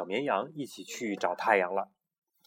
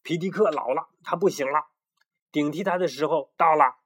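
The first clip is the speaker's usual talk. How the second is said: higher and louder boastful proclamation; bossy young rooster; 5 semitones higher, 5 dB louder than usual. Acted. The chubby rooster tells the others Pidik must go, cocky. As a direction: announce the takeover with swagger. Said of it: "小胖墩儿宣布说".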